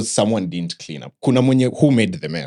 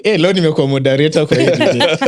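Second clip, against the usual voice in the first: mock moderator voice; 7 semitones higher, 7 dB louder, faster than usual. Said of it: "for the sake of sounding like a moderator".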